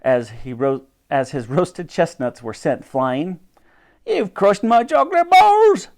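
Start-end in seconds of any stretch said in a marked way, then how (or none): 4.1-5.9 high, loud wail of outrage; hollered complaint. A narrator's reading. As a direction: holler it across the market. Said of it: "hollered a familiar voice".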